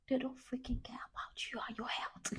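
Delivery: hushed, breathy whisper; whispered aside. Real, not acted. Mother whispering an aside about careless toddlers. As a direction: whisper it like a confession.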